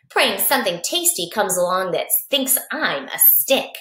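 usual speaking voice

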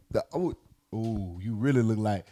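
usual speaking voice

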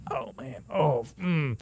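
a soft, sleepy mock groan; groggy roommate. Roommate woken by the late call, a soft sleepy groan. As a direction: grumble as if woken at night. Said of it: "your roommate's like".

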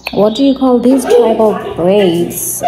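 usual speaking voice

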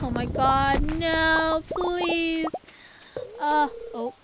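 loud, high pleading cry; panicked cry. Real, not acted. Player pleading as the storm threatens her crops, loud panic. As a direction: beg the game to stop it.

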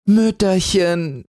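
high, drawn-out happy call; children calling joyfully to mother; jubilant cry. Acted. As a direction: kids rushing home to their new mother, cheering. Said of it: "riefen sie jauchzend".

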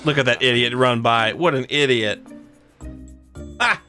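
usual speaking voice

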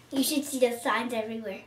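ordinary talk, nothing marked

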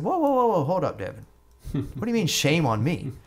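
high, startled mock objection; retold indignant protest. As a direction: push back, taken aback, pitch jumping up. Retold protest at being scolded.

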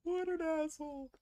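mock high insult. Real, not acted. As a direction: sneer at the opponent in a put-on high voice.

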